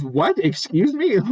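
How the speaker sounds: high, startled excuse me; mock shock. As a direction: act caught off guard by the question. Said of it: "I was so, like".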